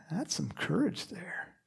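soft, awed whisper; whispered aside. Real, not acted. Preacher to congregation, a soft awed whisper. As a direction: lean in and whisper it. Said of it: "whispered aside".

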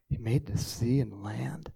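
ordinary talk, nothing marked

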